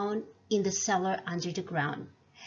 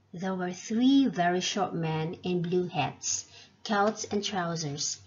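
ordinary delivery, nothing marked

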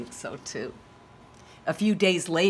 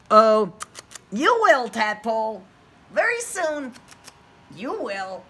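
louder, kind rabbit voice; reassuring rabbit; 3 semitones higher, 6 dB louder than usual. Acted. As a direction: promise it warmly.

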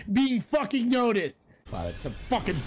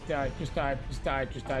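muttered curse; 5 dB quieter, faster than usual. Real, not acted. Muttering at opponent.

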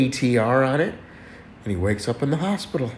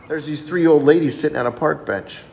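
normal talking voice

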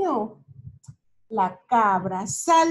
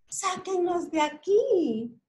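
little truck pleading; 6 semitones higher than usual. Acted. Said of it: "gritó el camioncito azul".

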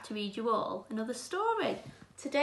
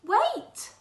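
sudden call; 12 semitones higher, 10 dB louder than usual. Acted. Calls out in the story.

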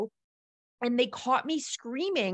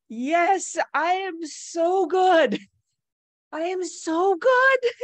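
higher, louder and slow, as if crying with happiness; tearful joy; 8 semitones higher, 6 dB louder, much slower than usual. Retold or imitated. Answering the worried boat guy, laughing through happy tears. Sobbing with joy among dolphins.

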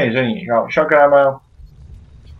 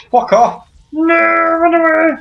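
frantic shout; 10 semitones higher than usual. Real, not acted. Shouting at allies.

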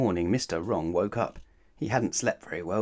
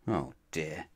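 rueful aside; 6 semitones lower, 8 dB quieter than usual. A narrator's reading.

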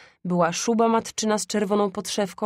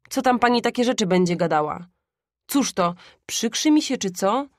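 lively, louder indignant father; mildly offended father brushing off gossip; indignant retort; 3 semitones higher, 4 dB louder than usual. A narrator's reading. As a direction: snap back at a meddling gossip. Father scolds the gossiping woman.

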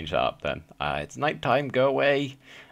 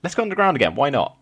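excited; 9 semitones higher, 4 dB louder, faster than usual. Real, not acted. Excited dive underground.

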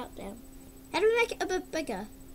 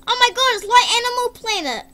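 high and loud with delight; excited; 7 semitones higher, 10 dB louder, faster than usual. Real, not acted. Amazed by the night vision effect, loud and excited. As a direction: cry out in amazement.

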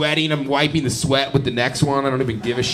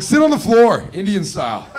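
mock manager order; 7 semitones higher, 7 dB louder than usual. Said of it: "He's like".

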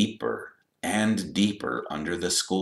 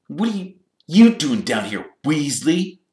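high, loud scornful question; sneering; 9 semitones higher, 5 dB louder than usual. A narrator's reading. Malfoy confronting Percy in the corridor, sneering. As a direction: toss the surname out with contempt. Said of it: "he sneered".

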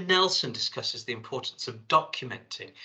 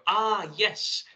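higher, louder "aha" of discovery; sudden realisation; 9 semitones higher, 5 dB louder than usual. Acted. Imagines someone in the shower getting an idea, acted sudden realisation. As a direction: let the penny drop out loud.

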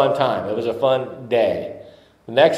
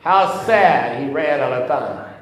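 high, loud hammed-up Italian accent; leading the congregation in a hammed-up Italian accent; 7 semitones higher, 4 dB louder than usual. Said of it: "you have to do it in an Italian voice".

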